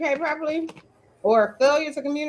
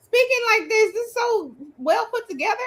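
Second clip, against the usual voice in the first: pitched-up impressed reaction; impressed surprise; 7 semitones higher than usual. Retold or imitated. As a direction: react like you're amazed by the email. Imitating impressed email reader.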